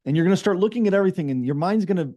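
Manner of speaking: ordinary delivery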